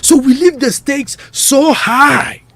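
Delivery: loud emphatic. Real, not acted. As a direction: push it out loud and hard. Host stressing high stakes.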